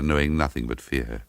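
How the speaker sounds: ordinary delivery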